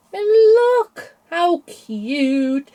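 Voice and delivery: drawn-out, high, loud coo; slow squealing delight at something cute; cooing over cuteness. Real, not acted. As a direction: gush over how adorable it is. Cooing over cute stitched bunny.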